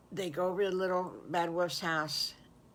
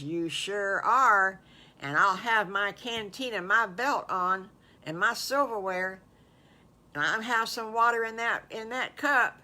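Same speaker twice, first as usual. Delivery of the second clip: louder, higher storybook wolf voice; eager wolf; 4 semitones higher, 5 dB louder than usual. Acted. Wolf promises Piggly he'll be ready, eagerly. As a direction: promise it with keen emphasis.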